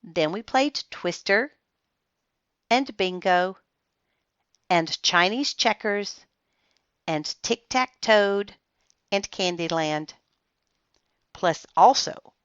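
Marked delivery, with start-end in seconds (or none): none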